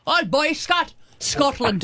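lightly put-on Scottish lilt on "Boysack"; playful mock Scottish "Oh, Boysack, Scotland"; mock Scottish accent. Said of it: "let's do this with a Scottish accent".

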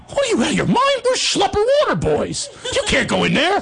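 high, squeaky mock disbelief; incredulous teammate. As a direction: protest in a silly high voice. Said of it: "so Steiny goes".

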